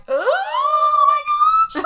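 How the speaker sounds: shrieked high, loud oh my gosh; hammed-up horrified shriek; mock shock. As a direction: yelp it in horror. Husband finds blood in cellar.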